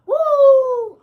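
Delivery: high, loud wrestler woo; Ric Flair woo. Retold or imitated.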